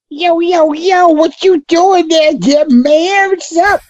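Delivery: high, drawn-out hey-man voice; mock urban greeting. Shown as a demonstration. Greeting folks in a not-quite-urban area, mock urban. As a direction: put on a high suburban-urban slang voice. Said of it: "suburban, but may as well be urban".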